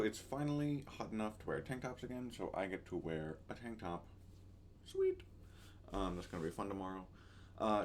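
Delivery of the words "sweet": sudden high squeak of delight; a quick thrilled yelp; excited. Real, not acted. Pleased about wearing tank tops.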